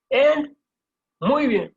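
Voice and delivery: high, loud cheer of muy bien; excited praise. Real, not acted. Teacher cheering students at the end of the lesson, excited. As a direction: cheer the class on at the finish.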